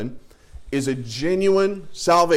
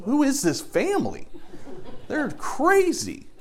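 a much higher, laughing cashier voice; mock cashier; 11 semitones higher, 3 dB quieter, slower than usual. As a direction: squeak it out in disbelief, as if chuckling. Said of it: "that guy's gonna look at me and go".

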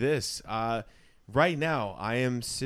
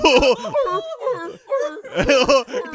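very loud, high laughing squeal; cracking up imitating the neighbors; 14 semitones higher, 13 dB louder than usual. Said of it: "what do they sound like".

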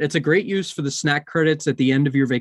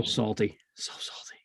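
whispering; 5 semitones lower, 10 dB quieter than usual. Real, not acted. Complaining about turkey leg.

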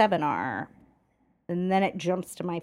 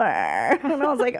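usual speaking voice

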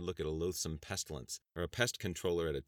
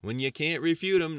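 higher, louder retort with a grin; lighthearted retort; 10 semitones higher, 10 dB louder than usual. Acted. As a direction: toss it back grinning. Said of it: "Knight retorted with a lighthearted grin".

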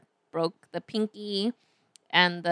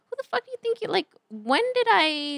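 indignant rant; 10 semitones higher than usual. Retold or imitated. Retelling rage at boss.

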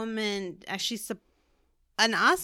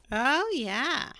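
Midwestern housewife; 4 semitones higher than usual. Pretend. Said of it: "I sounded like a Midwestern housewife".

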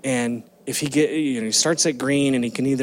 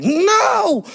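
put-on much higher, louder child's no; retold scream; 5 dB louder than usual. Father imitating his son screaming from his room, loud. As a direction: yell it like a scared kid. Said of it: "he was screaming".

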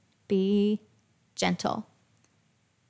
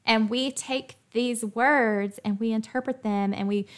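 ordinary delivery

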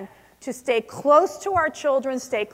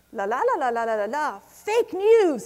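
mock Portuguese babble. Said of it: "They were talking Portuguese".

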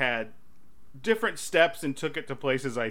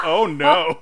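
loud, raised-pitch reaction; 8 semitones higher, 7 dB louder than usual. Retold or imitated. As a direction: blurt it out in mock dismay. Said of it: "like, out loud".

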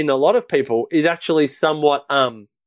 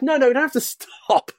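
mock protest; 9 semitones higher than usual. Dad answers on the toboggan, high mock protest. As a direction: imitate a high, insistent refusal to stop. Acted reply in the toboggan story.

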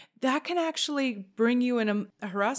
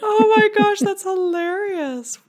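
delighted shriek; 10 semitones higher, 7 dB louder, slower than usual. Real, not acted. Laughing shock at gossip.

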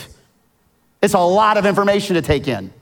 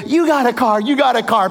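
higher, hyped game-show announcing; Oprah giveaway; 5 semitones higher, faster than usual. Retold or imitated. Host hands out cars to the studio audience, hyped. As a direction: hype up the crowd like a host. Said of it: "Remember when Oprah gave away".

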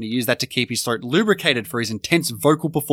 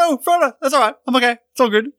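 high mock hobbit calls; hobbits calling out; 10 semitones higher, 3 dB louder, faster than usual. Friends act out the cliff fall, high mock hobbit calls. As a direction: call out in a high, put-on hobbit voice. Acting out the fallen hobbit scene.

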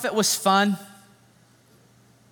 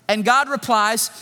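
ordinary delivery

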